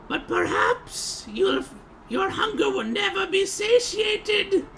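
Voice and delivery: high, slow, menacing accusation; accusing witch. Acted. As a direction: point and condemn him with relish. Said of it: "point a finger at you and say".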